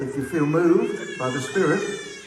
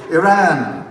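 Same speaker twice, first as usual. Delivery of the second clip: loud, raised roll-call cry; 4 semitones higher, 8 dB louder than usual. Real, not acted. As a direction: project the name to the crowd. Roll call of countries to crowd.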